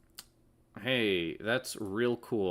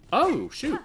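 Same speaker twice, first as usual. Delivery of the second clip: surprised; 8 dB louder than usual. Real, not acted. Sudden setback in game.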